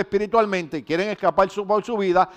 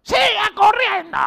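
much higher, louder, slow drill-sergeant bark; sergeant yelling; 5 dB louder, slower than usual. Retold or imitated. Sergeant yells at recruit who stopped to help. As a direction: bellow the order like a sergeant on a march. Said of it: "el sargento me grita".

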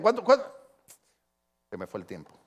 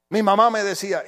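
ordinary delivery

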